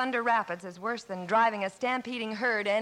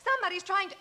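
high, distressed worry; anxious, pitched-up alarm; 10 semitones higher than usual. Acted. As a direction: cry out in dismay over a threatened livelihood. Alarmed for her threatened logs.

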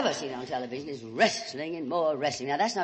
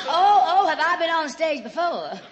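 flustered laugh; 11 semitones higher, 6 dB louder than usual. Acted. Caught out, laughing high.

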